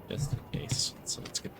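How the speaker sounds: breathy whisper, hardly any voice; whispered aside. Real, not acted. Streamer whispering to chat while grabbing notebook. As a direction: whisper it to yourself, just in case.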